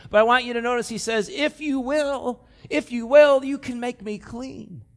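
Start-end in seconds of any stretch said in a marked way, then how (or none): 1.3-4.8 higher, pleading plea from the knees; begging leper. Acted. Kneeling leper begging Jesus, higher urgent plea. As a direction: beg for mercy, urgent. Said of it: "He's begging him".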